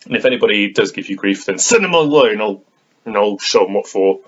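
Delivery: forceful, loud, rising warning; loud protective threat. Pretend. Vlogger defending someone from any grief, loud threat.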